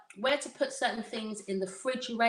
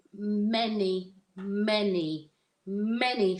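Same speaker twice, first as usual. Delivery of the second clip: MANY stressed three times, each drawn out separately; emphatic repetition; slower than usual. Real, not acted. Speaker stressing how widespread it is across schools, emphatic MANY, MANY, MANY. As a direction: lean on every MANY, spacing them out. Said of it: "I emphasize on the word many".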